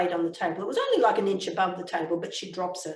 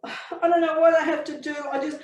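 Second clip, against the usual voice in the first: high, tearful pleading apology; acted weepy, desperate contrition; remorseful husband; 4 semitones higher than usual. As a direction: beg forgiveness, close to sobbing. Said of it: "The remorse is genuine".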